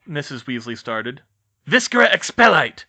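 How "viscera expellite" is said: high, loud spell cry; curse shout. Acted. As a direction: fire the dark curse. Villain casts curse.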